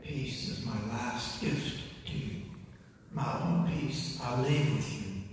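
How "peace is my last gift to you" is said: ordinary talk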